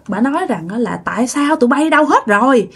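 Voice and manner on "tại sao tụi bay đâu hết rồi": high demanding spirit voice; possessed cursing. Retold or imitated. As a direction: yell for food as the possessed grandma. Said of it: "bà chửi um sùm trời đất". (giọng bà bị nhập đòi ăn, chửi ầm)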